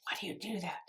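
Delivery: whispering the husband's question; a hushed mock husband nagging; wife whispers her husband's question, mock nagging; whispered quote. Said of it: "gets on my case".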